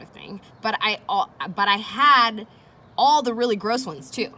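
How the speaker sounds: put-on expressive read-aloud for children; reading aloud to kids. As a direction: read it like a teacher hooking the class. Reads diary entry to class.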